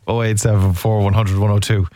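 ordinary talk, nothing marked

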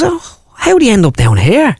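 very high-pitched North Side Dublin delivery man; comic high north Dublin voice; north side Dublin accent. Acted. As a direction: squeal it up high in broad Dublin. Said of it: "a North Side dub".

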